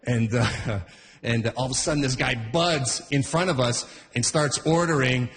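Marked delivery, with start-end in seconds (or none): none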